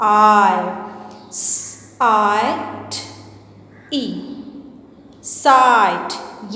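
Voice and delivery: letters spelled out, then साइट drawn out slowly with a long i; spelling out sight. Shown as a demonstration. Teacher spelling out a word for students, slowly and clearly. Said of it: "means second sound".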